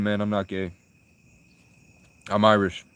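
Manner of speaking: ordinary talk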